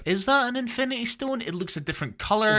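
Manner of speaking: high, squeaky mock-puzzled voice; mock surprised squeak. Retelling own dumb reaction to the diamond, high squeaky mock surprise. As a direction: squeak it like a confused kid spotting a shiny gem. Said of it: "I was like, I was like".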